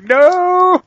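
high, loud, drawn-out "no"; mock crowd protest. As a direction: imitate a whole crowd objecting. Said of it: "Everybody's just like".

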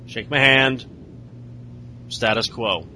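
low, soft, halting brush-off; cool rebuff. Acted. Stephanie turning down a hug backstage, cool rebuff. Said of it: "And she's all".

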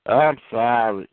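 slow, drawn-out grudging sorry; insincere apology. Acted. Child corrected by a parent, still apologizing insincerely. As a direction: drag out an apology you don't mean. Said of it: "you don't say that right".

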